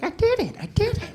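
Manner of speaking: put-on very high kid pleading; whiny insisting. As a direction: insist like a desperate little kid. Little brother insisting.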